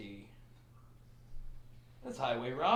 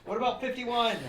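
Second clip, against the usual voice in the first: loud, high call after someone; 12 semitones higher, 11 dB louder than usual. Acted. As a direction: shout it as the door shuts. Shouted through closing door.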